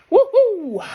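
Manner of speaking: high, loud whoop of joy; cheer. Real, not acted. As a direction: celebrate with a big whoop. Cheering about glitter.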